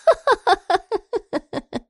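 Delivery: high staccato cackle, falling away; long high laugh that slowly dies down; crazed laugh. A narrator's reading. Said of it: "洛言又笑了起来".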